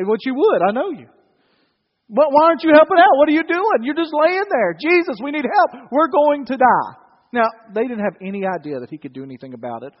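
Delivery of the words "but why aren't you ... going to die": high, loud, exasperated plea; acted panic, begging for help; desperate pleading. Sailor to sleeping Jesus while bailing water, desperate.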